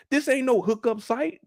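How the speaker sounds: normal talking voice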